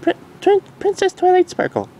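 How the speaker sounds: very high filly voice; curious filly. Acted. Dinky asking her mom, curious filly. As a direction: ask with childlike curiosity.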